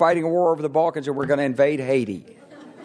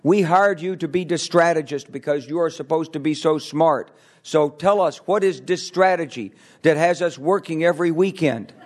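imitated Polish accent, emphatic; a boss's pointed demand in Polish-accented English; 3 semitones higher than usual. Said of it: "in his Polish accented English".

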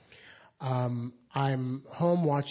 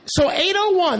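normal talking voice

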